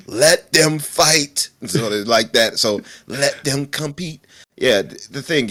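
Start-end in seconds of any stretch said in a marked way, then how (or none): 0.1-1.5 slow, higher, louder film-quote voice; movie line impression. Friend continuing the trend of badly used movie lines, drawn-out impression. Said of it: "badly using movie lines". 3.1-4.2 slow, pitched-up film quote; movie line impression. Friend adapting the movie line to competition, slow dramatic impression. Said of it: "badly using movie lines".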